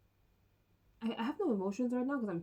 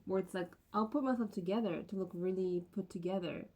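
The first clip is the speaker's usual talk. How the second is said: ordinary talk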